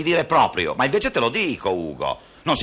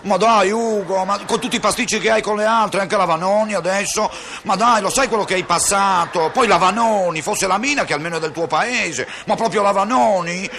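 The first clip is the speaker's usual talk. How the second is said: scandalised; 5 semitones higher than usual. Acted. Conscience pleads, dismayed.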